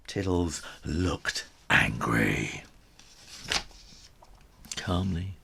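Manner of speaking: ordinary delivery, nothing marked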